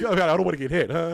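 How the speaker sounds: mock scared kid. Scared kid voiced in story.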